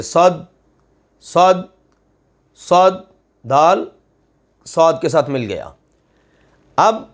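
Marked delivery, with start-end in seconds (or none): 0.0-2.9 صد repeated, the د joined firmly to the ص; tajweed drill. Shown as a demonstration. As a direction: drill صد, joining the letters. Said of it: "اب صاد کے ساتھ اس کو ملا دیں گے". (صد کو صاد کے ساتھ ملا کر)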